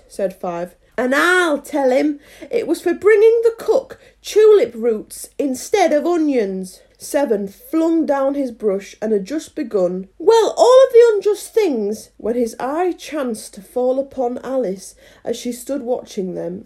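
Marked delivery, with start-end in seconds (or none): 1.0-6.8 high, loud acted tale-telling; tattling gardener. Five spitefully tells on Seven. 10.2-12.1 very high, loud acted indignation; indignant outburst. Seven flings brush, begins outburst.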